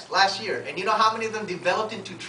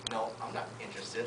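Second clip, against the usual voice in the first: low, very soft imitated brush-off; dismissive refusal; 8 semitones lower, 14 dB quieter than usual. Prospect at gas station to recruiter, dismissive refusal. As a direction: turn them down quietly, pitched well down.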